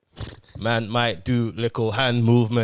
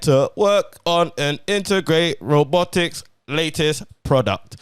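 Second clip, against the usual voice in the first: robot voice; 6 semitones higher, 6 dB louder than usual. Pretend. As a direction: read the synopsis like a robot. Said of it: "Gotta read it like a robot".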